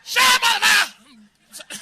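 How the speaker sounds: slow loud barked threat; armed robber. Pretend. Imagined robber silences the comic, slow loud bark. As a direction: shout it down like a gunman. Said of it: "come out of the bush".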